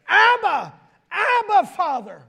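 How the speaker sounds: loud, high, pleading cry to God. Acted. Preacher crying out to God on behalf of believers. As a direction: call out like a child to its father. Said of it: "thank God there's a cry".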